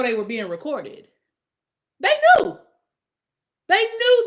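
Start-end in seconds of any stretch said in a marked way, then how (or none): 2.0-4.2 high, loud burst of insistence; sudden high exclamation; emphatic insistence. Real, not acted. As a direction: shout it out to drive the point home. Insisting they were aware of recording.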